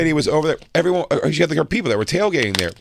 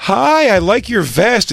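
slow, higher sweet compliment; a kind lady being friendly, drawn out; lightly voiced sweet mom; 7 semitones higher, much slower than usual. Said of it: "a lady goes".

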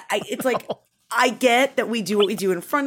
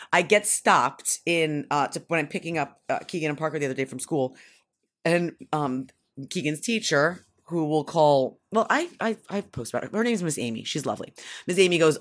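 ordinary delivery

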